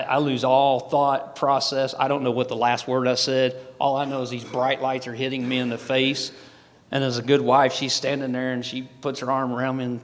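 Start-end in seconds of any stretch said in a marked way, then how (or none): none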